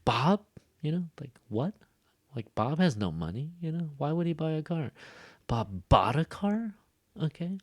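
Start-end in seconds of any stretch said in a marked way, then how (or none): 0.0-0.4 "Bob?" rising high in disbelief; incredulous "Bob?", pitched up; surprised questioning BOB. Shown as a demonstration. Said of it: "to show surprise". 5.5-6.7 "bought" punched and stretched in disbelief; surprised echo question leaning on "bought"; surprised stress on BOUGHT. Shown as a demonstration. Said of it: "the verb bought is, like".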